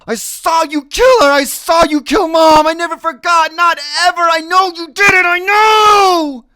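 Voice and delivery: high, loud, frantic accusation; accusing scream. Acted. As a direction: scream the truth at him. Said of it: "I'm going".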